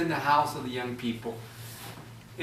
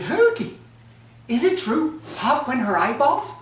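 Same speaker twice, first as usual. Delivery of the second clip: put-on teasing young folk; 10 semitones higher, 4 dB louder than usual. Young people teasing Hauti.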